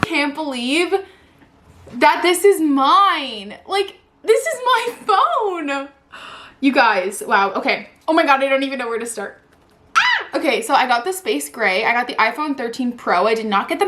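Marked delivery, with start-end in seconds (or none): 0.0-5.9 much higher, slow, voice cracking with joy; half-crying from happiness; overwhelmed tearful delight; close to tears. Real, not acted. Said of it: "I'm gonna cry". 10.0-10.4 short high delighted shriek; tiny thrilled shriek; excited squeal. Real, not acted. Excited unboxing.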